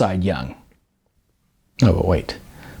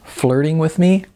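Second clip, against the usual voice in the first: higher, gushing, fast chatter; bubbly starlet; 5 semitones higher than usual. A narrator's reading. Model telling the class about her film, bubbly. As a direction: gush about your movie role breathlessly. Tracy's voice continues.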